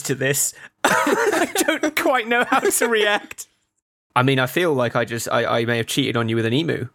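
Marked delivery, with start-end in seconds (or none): 0.8-3.5 giggling, can barely get it out; laughing. Real, not acted. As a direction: laugh through the words, pitched up. Laughing confession.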